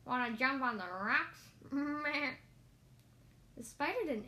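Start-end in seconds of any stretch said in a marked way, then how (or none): 0.0-2.3 bleating goat question; playful storybook goat. Acted. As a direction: talk like a goat, ending on a bleat. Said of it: "said the goat".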